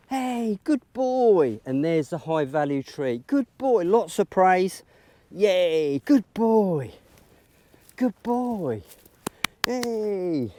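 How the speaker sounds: high, loud cheering praise; excited dog praise. Real, not acted. Trainer rewarding her dog with lots of praise, high excited cheer. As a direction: make a big fuss of him. Big praise after the find.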